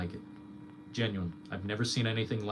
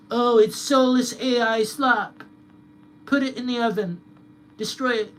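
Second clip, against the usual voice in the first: high, loud sneering mock voice; mocking whiny critic; 12 semitones higher, 7 dB louder than usual. Streamer mocking online critics in a high whiny voice. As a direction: whine it like a crybaby complaining about AI. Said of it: "now that we get this".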